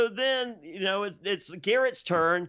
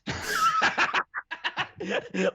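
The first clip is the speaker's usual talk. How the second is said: squealing laugh breaking a quote; high burst of laughter. Real, not acted. Podcaster retelling the date, a squealing laugh. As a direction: crack up high mid-story.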